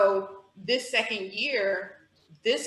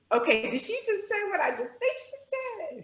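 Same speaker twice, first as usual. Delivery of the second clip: high, disbelieving inner voice; stunned double take; 11 semitones higher than usual. Retold or imitated. Hugging the coach after her confession, high startled disbelief. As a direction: catch yourself in disbelief. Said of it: "everything in me was like".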